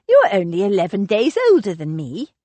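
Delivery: a playful protest, not truly hurt; mock indignation. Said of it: "in mock indignation".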